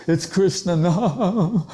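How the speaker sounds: imitating a tearful devotee; mock weepy devotee. So-called devotee crying over Krishna, put-on weeping. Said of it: "so-called devotees who are like that".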